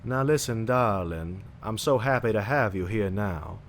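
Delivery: imitated old-time Georgia accent; old Southern accent. Shown as a demonstration. Greeting a darling, demonstrating the old Georgia accent. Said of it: "it would be like".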